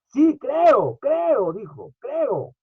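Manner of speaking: father pleading with Jesus, voice high and strained. Retold or imitated. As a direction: cry out your faith like a desperate father. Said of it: "Este hombre dijo".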